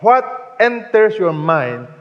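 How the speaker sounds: slow it down and drive the question home; weighty slow question; emphatic repeat. Shown as a demonstration. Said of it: "Let me repeat".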